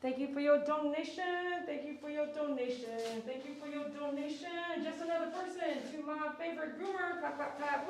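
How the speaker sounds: loud, bright stream-host thank-yous; donation shout-outs. Acted. Groomer thanking livestream donors, upbeat announcer read. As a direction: hype up every donor like an announcer.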